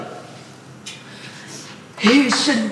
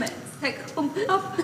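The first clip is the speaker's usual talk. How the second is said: much softer, sobbing through "Mẹ sẽ không khóc"; quiet sobbing; 3 semitones higher, 10 dB quieter, faster than usual. Acted. Mother sobs softly to her son, "Mẹ sẽ không khóc" half-swallowed. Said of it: "Mẹ đừng có khóc nữa".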